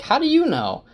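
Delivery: shoots up high in surprise; startled high squeak of a question; surprised. Acted. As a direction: caught off guard, voice jumps up. Perrin startled by Gaul.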